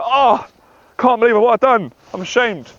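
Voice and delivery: imitating a man disgusted with himself; mock ashamed groan. Alone after porn, voicing his shame in a mock ashamed groan.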